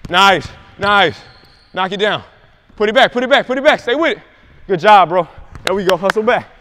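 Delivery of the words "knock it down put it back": usual speaking voice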